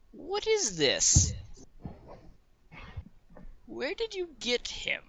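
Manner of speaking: put-on high, slow old-lady voice, thinning to a whisper; elderly woman. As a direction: draw it out like an aged shopkeeper peering at a curiosity. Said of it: "it's, like, an elderly woman".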